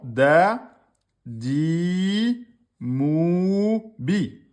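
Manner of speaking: loud spelled-out syllables, each on its own; sounding out a word slowly for students. Shown as a demonstration. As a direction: call out each syllable for the class. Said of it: "Quatre syllabes".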